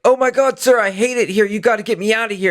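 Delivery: high-pitched, rushed damsel plea; intentionally bad actress. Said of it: "she's like an intentionally bad actress".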